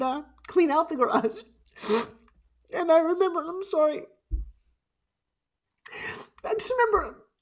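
choked up, pushing through real tears; real tearful emotion. Real, not acted. Speaker remembering clearing out the dead man's garage, tearfully emotional. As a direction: voice rising high as tears break through. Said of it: "why I'm quite so emotional".

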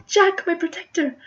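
high, loud swooning cry; adoring heroine. Acted. Ermengarde bids her protector farewell, swooning. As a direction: gush over your hero in a high voice. Ermengarde cries to departing hero.

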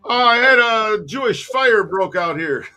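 mock store owner. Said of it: "somebody said".

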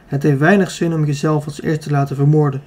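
plain reading voice

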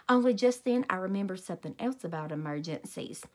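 plain reading voice

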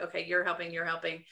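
low, soft teacher directing helpers; softly imitating a voiceless teacher; hushed retold instructions. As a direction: hand out jobs under your breath. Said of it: "whispered everything that I needed".

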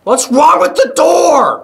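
high, loud, drawn-out cry; alarmed shout. Real, not acted. Alarmed at game door.

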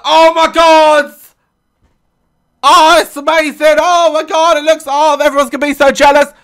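thrilled daughter. Retold or imitated. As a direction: gush over the volcano like a kid. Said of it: "She's like".

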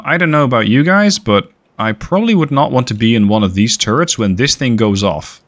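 ordinary talk, nothing marked

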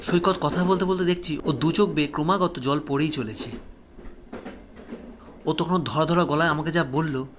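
plain reading voice

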